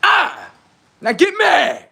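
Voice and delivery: high taunting shout; taunting yell. Acted. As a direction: shout to provoke them.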